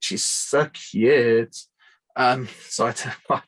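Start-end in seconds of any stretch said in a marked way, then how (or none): 0.0-1.6 put-on very slow, drawn-out gushing girl voice. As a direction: gush it out like a bubbly sorority girl.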